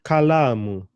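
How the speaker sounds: Kalamu with a punched, lengthened la; Kalamu, weight on la; stress on LA. Shown as a demonstration. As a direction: hit the middle syllable la in Kalamu. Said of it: "my emphasis is on the la".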